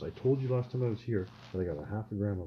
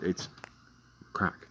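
usual speaking voice

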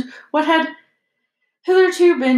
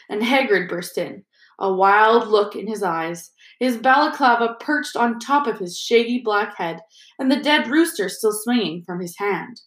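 usual speaking voice

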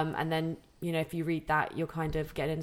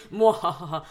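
spooky cackle; evil laugh sound effect; 5 dB louder than usual. Pretend. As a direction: cackle like a cartoon villain. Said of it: "the sound effects we're doing".

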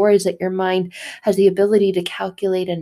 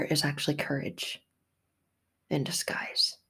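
whisper; 6 semitones lower, 11 dB quieter than usual. Real, not acted. Whispered end of point.

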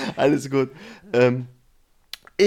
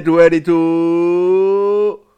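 hugely stretched, louder announcer call; ring announcer; 4 semitones higher, 11 dB louder, much slower than usual. Pretend. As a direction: belt it like a title fight. Parody of famous catchphrase.

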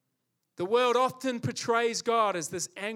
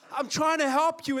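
higher and louder frustrated plea; exasperated plea; 7 semitones higher, 5 dB louder than usual. Retold or imitated. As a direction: insist you only want to help. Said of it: "And you're like".

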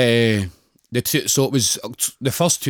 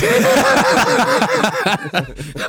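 high burst of laughter; loud laugh; 3 dB louder than usual. Real, not acted. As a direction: crack up laughing. Big laugh at dildo joke.

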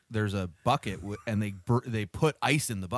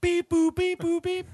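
robot voice; 6 dB louder, slower than usual. Pretend. Robot noises again.

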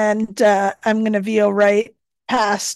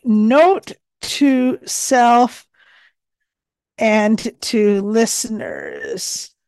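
slow, deliberate dictation; dictating; slower than usual. Acted. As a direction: say it slowly for speech to text. Dictating a note line.

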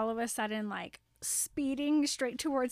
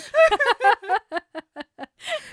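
big, high, loud cackle of delight; loud burst of laughter; 8 semitones higher, 13 dB louder than usual. Real, not acted. Cracking up as George hits Snape with a bludger, loud laughter. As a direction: explode into laughter at the joke.

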